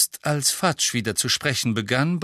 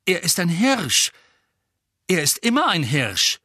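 impatient; 7 semitones higher, 5 dB louder than usual. Acted. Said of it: "ungeduldig und leicht verzweifelt zugleich".

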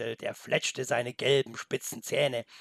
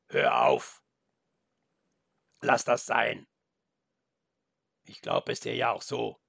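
wolf voice; 2 semitones lower than usual. Acted. Wolf stops the boy.

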